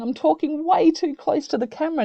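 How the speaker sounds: loud high voice right on the microphone; too close to mic. Shown as a demonstration. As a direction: demo talking too close to the camera. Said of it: "What's happening here if I'm talking".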